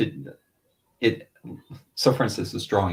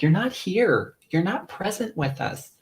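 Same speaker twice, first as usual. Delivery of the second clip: high-pitched mock wife's complaint; high, drawn-out impression of a complaining wife; imitating wife; 11 semitones higher, slower than usual. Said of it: "she's like".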